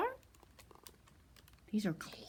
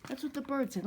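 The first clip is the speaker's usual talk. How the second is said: ordinary delivery, nothing marked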